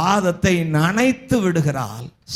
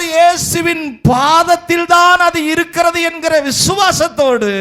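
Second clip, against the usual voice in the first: higher, louder, building to a shout; full-force preaching; 6 semitones higher, 3 dB louder than usual. Acted. Preacher urges congregation about repentant woman, full-force preaching. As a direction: preach it out, rising to a cry.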